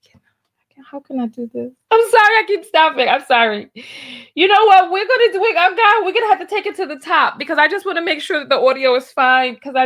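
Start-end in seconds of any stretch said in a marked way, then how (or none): 0.0-1.7 whispered, barely there; helpless whisper. Real, not acted. Reacting to a video, a helpless whisper. 1.9-6.8 flustered apology. Real, not acted. Apologising while laughing at clip.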